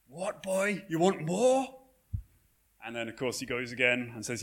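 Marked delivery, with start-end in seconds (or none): 0.1-1.8 high, put-on voice of an indignant master; outraged master. Workhouse master to Oliver who asked for more, outraged. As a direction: play the master aghast at the boy's request. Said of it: "he just turns red".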